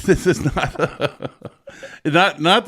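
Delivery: words breaking into real laughter; can't keep a straight face, laughing through it. Real, not acted. Embarrassed guest laughing at the host's dating joke.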